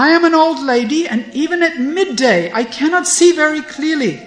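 loud, high-pitched indignant rant; angry. A narrator's reading. Grandmother scolds the rogue over her granddaughter, angry rant. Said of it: "Mukhara gets angry again and says".

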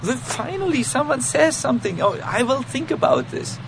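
high, bright-eyed happy acceptance; gladly accepting. Retold or imitated. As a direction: play the devotee gladly taking criticism. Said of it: "take it with great happiness".

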